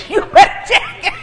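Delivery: high, loud, slow, half laughing; hammed-up warning, high and loud; playful threat, high and loud through laughter; laughing mock threat.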